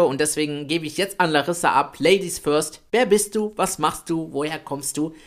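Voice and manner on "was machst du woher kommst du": ordinary talk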